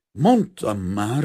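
slow, loud, winded protest; panting reproach. A narrator's reading. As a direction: heave it out, out of breath. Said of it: "lihegte az áruház tulajdonos".

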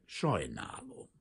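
slow, cool reply, pitched low; coolly official, dragging the word out. A narrator's reading. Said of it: "felelte hűvösen".

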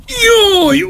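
high howl of pain; scream of pain. A narrator's reading. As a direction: yell out in sudden agony. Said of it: "ordított föl a fájdalomtól".

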